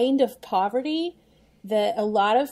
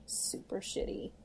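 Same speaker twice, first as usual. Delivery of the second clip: under-the-breath whisper; whispered aside; 13 dB quieter than usual. Real, not acted. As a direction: drop to a whisper for the rude word. Blunt aside about war-torn Korea.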